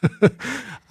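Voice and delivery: bright laugh up high; a quick amused laugh at the joke; one quick burst of real laughter; short laugh. Real, not acted. Laughs at "me too.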